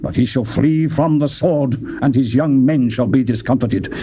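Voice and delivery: plain reading voice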